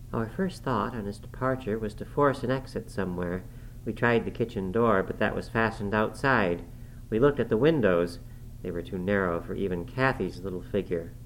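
plain reading voice

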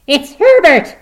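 put-on very loud, high-pitched old woman's scream; full-force high shriek. A narrator's reading. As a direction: scream the name in wild realization. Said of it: "she screamed".

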